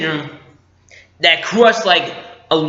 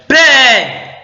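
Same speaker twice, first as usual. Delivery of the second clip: high, loud call that squeaks; squeaking shout; 9 semitones higher, 6 dB louder than usual. Retold or imitated. Fan acting Han Solo calling his son, voice squeaking. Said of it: "sorry, my voice squeaked".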